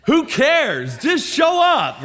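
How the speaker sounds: high, loud, fed-up who cares, slowing on just show up; exasperated. Retold or imitated. Youth pastor fed up with students asking who's going, exasperated and loud. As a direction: throw up your hands at them. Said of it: "I'm just like".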